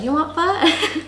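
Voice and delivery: lightly voiced mom popping her head in; ex-boyfriend's mom asking. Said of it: "she's like".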